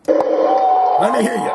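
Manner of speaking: slow, loud, high rock-star cry; hammed-up rock call. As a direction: drag it out to the crowd. Gene's mic call.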